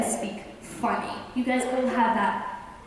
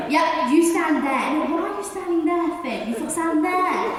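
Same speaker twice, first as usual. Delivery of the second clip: bossy director; 6 semitones higher, 4 dB louder than usual. Retold or imitated. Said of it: "I'm very bossy".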